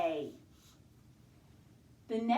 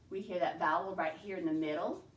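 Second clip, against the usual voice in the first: normal talking voice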